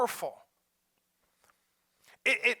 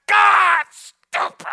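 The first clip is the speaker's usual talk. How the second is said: self-scolding; 11 semitones higher, 12 dB louder, much slower than usual. Acted. Scolding oneself.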